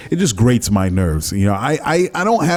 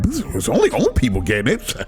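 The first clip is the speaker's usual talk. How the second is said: mock callous voice; 9 semitones higher than usual. Mocking callous people, laughing.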